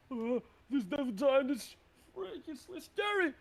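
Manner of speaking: high, strained panic of a giant; terrified yelling. Acted. Game master voices giants overwhelmed by spores, terrified yelling. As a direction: cry out in hammed-up terror.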